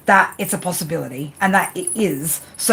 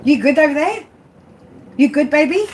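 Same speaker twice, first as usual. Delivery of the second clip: pet talk; 7 semitones higher than usual. Real, not acted. Cooing at her cat.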